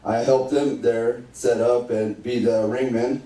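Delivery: ordinary talk, nothing marked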